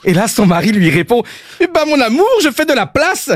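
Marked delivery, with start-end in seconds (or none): 1.6-3.3 put-on lightly Belgian accent, pitched higher; a hint of Belgian accent for the husband. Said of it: "mon accent belge". (léger accent belge)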